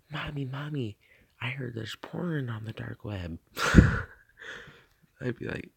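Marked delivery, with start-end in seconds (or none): 0.1-3.4 mock-innocent kid tattling; imitated little kid. Streamer playing a kid telling mommy, childlike mock innocence. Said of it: "go to their mom and be like".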